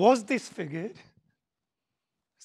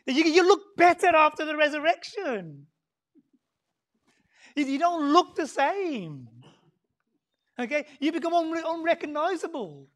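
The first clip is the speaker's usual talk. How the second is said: higher and louder, teasing; playful emphasis; 7 semitones higher, 8 dB louder than usual. Real, not acted. Preacher teasing the congregation about resurrection looks, loud and playful. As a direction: tease the listeners with a grin in the voice.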